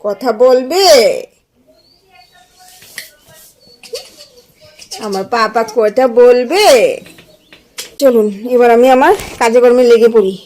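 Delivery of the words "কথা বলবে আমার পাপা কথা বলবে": tender, rising coaxing questions. Real, not acted. Mother coaxes her baby son to talk, sweet coaxing.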